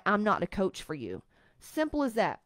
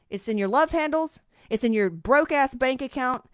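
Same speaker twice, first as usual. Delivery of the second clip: ordinary talk, nothing marked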